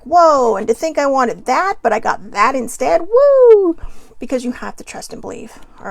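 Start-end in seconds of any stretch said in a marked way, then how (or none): 0.0-3.8 loud, rising joyful whoa ending in a high woo; delighted surprise. Retold or imitated. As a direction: act out a happy surprise, building to a cheer. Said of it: "is gonna be like".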